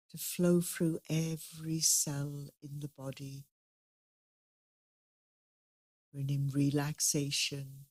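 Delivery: soft, unhurried meditation guide; guided meditation. Acted. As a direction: gently lead the group inward.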